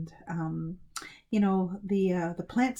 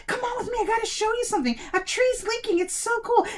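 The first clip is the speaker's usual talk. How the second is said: high-pitched, eager youngster; excited urging; 14 semitones higher than usual. A narrator's reading. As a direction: urge everyone along, thrilled. Boy urging villagers to follow.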